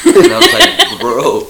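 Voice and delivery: loud, high laughing protest. Retold or imitated. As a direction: crack up at dad. Laughing at dad.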